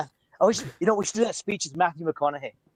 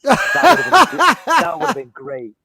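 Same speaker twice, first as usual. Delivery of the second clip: talking through laughter; laughing; 5 semitones higher, 8 dB louder, slower than usual. Real, not acted. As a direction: laugh while saying it. Laughing at own joke.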